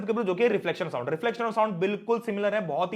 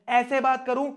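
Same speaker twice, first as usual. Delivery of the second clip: raised, higher-pitched demo voice; different voice, higher; 5 semitones higher than usual. Shown as a demonstration. Teacher showing students another way of talking, higher voice.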